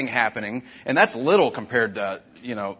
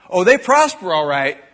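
emphatic; 7 semitones higher, 10 dB louder than usual. Real, not acted. Preacher on the wicked prospering.

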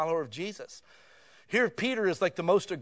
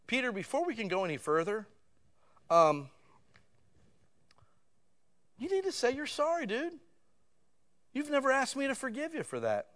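a hint of a stern Jesus, pitched higher; mock demanding Jesus; 7 semitones higher than usual. Imagined Jesus tells Peter to say sorry, lightly voiced.

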